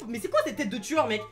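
ordinary talk

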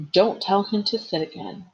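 plain reading voice

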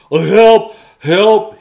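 high, loud cry for rescue; panicked storybook shout; yelling for help. Acted. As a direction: yell out like you're drowning. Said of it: "he yelled".

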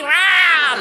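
loud, high sudden roar; comic lion's roar, full volume; imitated lion roar.

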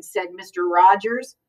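plain reading voice

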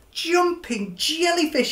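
mild mock groan, pitched high; a high little-fish exclamation with a hint of a groan. Stanley realizing he's late, a touch of a groan. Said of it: "he groaned".

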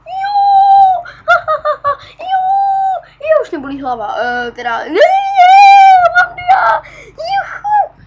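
very high, loud cheering and laughing; joyful yelling. Real, not acted. Gamer yelling over diamonds, ecstatic. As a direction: scream for joy at the diamonds. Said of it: "zase začnu řvát".

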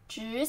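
职 rising in second tone. Shown as a demonstration. Teacher breaking down position for learners, rising tone on 职. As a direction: lift the pitch through 职. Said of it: "second tone".

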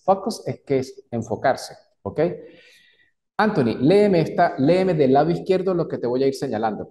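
usual speaking voice

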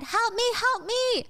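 high, drawn-out cries for help; frantic plea. Acted. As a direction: act out flagging down a car. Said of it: "frantically trying to get his attention".

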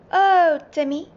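high, loud drawn exclamation; loud group-style cry; exclaiming at Timmy. A narrator's reading. Everyone exclaiming at Timmy's dream.

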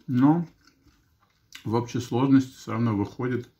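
chewing while talking; speaking between bites; mouth full. Real, not acted. Seen 5: Takes forkfuls of salad at -2 and +5; chews while speaking.

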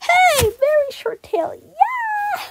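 squealed, very high celebration; way up high, mock-delighted whoop; high-pitched cheer. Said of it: "and I was like".

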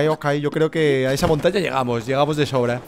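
normal talking voice

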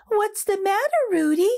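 a caring pal checking in; lightly voiced pepper friend.

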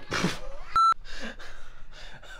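breathless giggle; airy burst of laughter; giggling. Real, not acted. Laughing at retake.

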